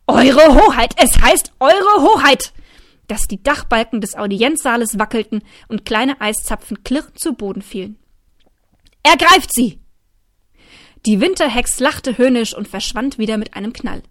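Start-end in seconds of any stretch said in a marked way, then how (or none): 0.1-2.5 higher, loud, slow royal bellow; shout. A narrator's reading. 9.0-9.8 higher, loud command; shouting. A narrator's reading. King orders guards.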